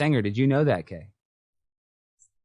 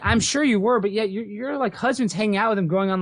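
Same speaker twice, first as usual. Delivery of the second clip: ordinary talk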